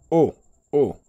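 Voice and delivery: O, O starting high and falling; two short falling O sounds; fourth and fifth tones. Shown as a demonstration. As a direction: drop each O firmly from a high, loud start. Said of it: "le quatrième".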